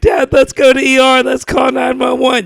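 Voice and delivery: high, loud, hammed-up kid's wail; mock crying plea. Crying son begging dad to get help, loud mock wail. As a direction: imitate a panicked child begging at a shout. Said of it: "I was crying".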